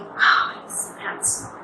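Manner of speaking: put-on breathless whisper, swooning over a handsome boy; admiring a handsome boy, whispered; whispered admiration. Shown as a demonstration. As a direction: sigh it out in a hushed gush. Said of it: "you want to say".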